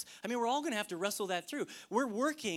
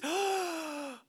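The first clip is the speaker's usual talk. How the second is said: awed gasp; 8 semitones higher than usual. Acted. Acting the wonder of discovery.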